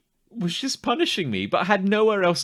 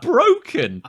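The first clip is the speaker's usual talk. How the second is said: laughing; 8 semitones higher, 5 dB louder than usual. Real, not acted. Laughing about crying over level.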